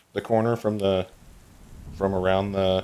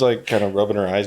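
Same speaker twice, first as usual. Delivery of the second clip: ordinary delivery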